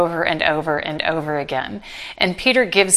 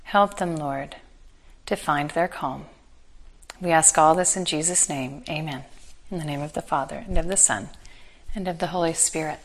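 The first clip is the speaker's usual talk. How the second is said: quiet prayer; 3 semitones lower, 6 dB quieter than usual. Acted. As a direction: pray it gently, head bowed. Closing prayer and sign of cross.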